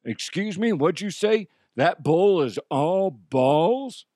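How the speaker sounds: cowboy voice. Acted. Cowboy asks the host about the bull, cowboy voice. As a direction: play a puzzled cowboy. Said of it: "Yes, cowboy".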